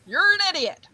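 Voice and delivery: high, sing-out echo voice. Acted. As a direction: call it back high and mocking. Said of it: "the Echo scene".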